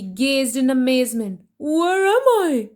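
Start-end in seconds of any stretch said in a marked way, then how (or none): 1.6-2.7 bewildered. Acted. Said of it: "he said".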